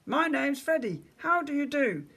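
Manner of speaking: very high, squeaky teddy voice; Freddy. A narrator's reading. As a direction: squeak it like a tiny talking toy. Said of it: "He held up his teddy and squeaked".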